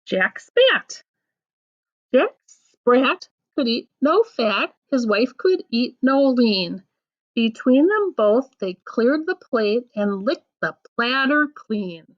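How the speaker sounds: bouncy nursery rhyme recital; reading to children. Acted. Adult reads nursery rhymes aloud.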